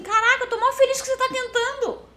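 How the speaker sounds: high-pitched excited cheer; delighted encouragement. Retold or imitated. Telling how she reacts to Brazilians trying English, thrilled. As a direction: cheer on a nervous learner.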